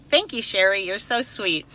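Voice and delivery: ordinary delivery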